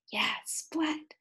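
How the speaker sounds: soft voiceless whisper. Shown as a demonstration. Teacher whispers Splat's name to the class, hushed. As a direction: breathe it like a secret.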